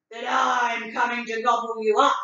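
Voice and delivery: loud, menacing troll voice; troll threatening the little goat; troll threat. Acted. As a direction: boom it out like a hungry troll.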